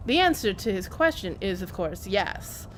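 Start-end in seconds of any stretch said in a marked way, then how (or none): none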